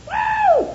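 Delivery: high joyful whoop shouted out; excited whoop. Retold or imitated. Preacher whooping at the mansion, high excited holler. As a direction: let out a high happy holler.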